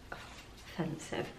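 breathy whispered judgement, dropped right down; a mock-disgusted aside under the breath; whispered verdict. Real, not acted. Artist whispering about her own old teapot, mock disgust.

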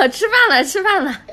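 high, loud call to dinner. Real, not acted. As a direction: call everyone to the table. Mother calls family to eat.

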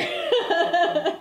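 loud squealing laugh; high burst of laughter. Real, not acted. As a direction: laugh hard at your own joke. Joke about butt falling off.

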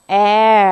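"heir" said very slowly with silent h, like air; "heir" modelled slowly, no h sound; pronunciation demo. Shown as a demonstration. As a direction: draw out "heir" clearly for the class. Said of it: "อันนี้อ่านว่า".

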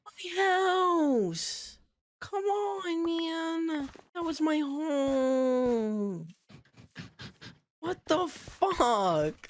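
high, slow hammed-up crying wail; mock wail. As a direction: wail over the lost house like a tragedy. House exploded, fake wailing.

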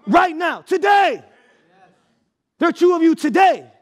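high, loud preacher's cry; full-force preaching. Real, not acted. Preacher to congregation about grace, full-force preaching.

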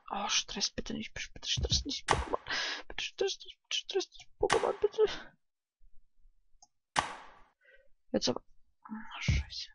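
whispered pleading to the game; soft tense whisper; nervous whisper. Real, not acted. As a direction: plead under your breath.

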